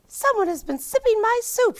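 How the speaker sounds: high, loud mother bear voice; a high, loud motherly bear growl; hammed-up high Mama Bear. A narrator's reading. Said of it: "she growled".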